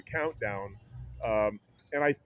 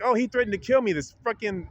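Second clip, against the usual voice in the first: mock cursing accusation; 6 semitones higher than usual. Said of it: "she's cursing me".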